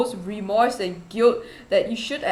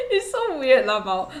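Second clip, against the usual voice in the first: giggling through the words; laughing. Real, not acted. Vlogger cracking up at her own Chinese, laughing. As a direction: laugh at how it sounded.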